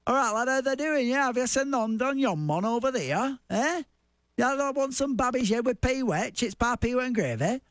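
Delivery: thick Wigan dialect, pitched well up; broad Wigan accent. Shown as a demonstration. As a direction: lay on the old Wigan talk from the north of England. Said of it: "this is how we talk".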